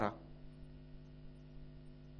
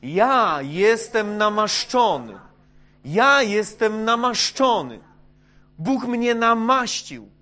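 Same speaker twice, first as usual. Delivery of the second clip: slow, much louder and higher declaration; proclaim aloud; 6 semitones higher, 11 dB louder, slower than usual. Shown as a demonstration. Pastor leading the congregation in a loud, slow declaration. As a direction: call it out for all to repeat.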